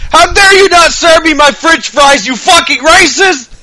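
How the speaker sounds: high-pitched mock outrage, drawn out at the end; hammed-up indignant yell at a server; mock screaming. Shia LaBeouf to the bartender over french fries, mock screaming. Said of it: "screaming racist at the fucking bartender".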